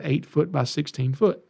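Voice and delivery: ordinary delivery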